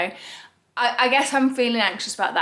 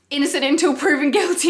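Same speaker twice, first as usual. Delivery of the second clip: chuckling through the words; laughing; 4 semitones higher, 4 dB louder, faster than usual. Real, not acted. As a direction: laugh as you say it. Half-joking reassurance, laughing.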